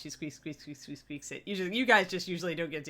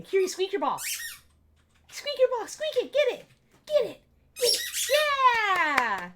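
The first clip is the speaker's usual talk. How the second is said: high, bright cheering at the dog; excited high-pitched pet voice; high dog talk; 6 dB louder than usual. Real, not acted. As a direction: coax the puppy to fetch. Urging her dog to play.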